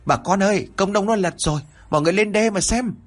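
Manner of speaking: high-pitched villager calling out; hô lớn. Acted. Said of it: "có một người hô lớn". (gọi to báo tin, giọng cao)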